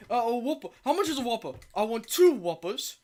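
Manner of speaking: put-on lightly done British accent; a hint of a British customer ordering. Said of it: "he had the most British accent ever".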